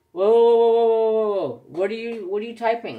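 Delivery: loud, high startled whoa whoa, stopping a friend; alarmed whoa. Retold or imitated. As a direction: shout him off the keyboard in a panic. Startled as friend types in chat.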